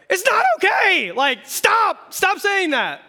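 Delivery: loud, high yell of frustration; hammed-up furious outburst; exasperated shout. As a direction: scream it like someone sick of being comforted. Acted reply to comforting friend.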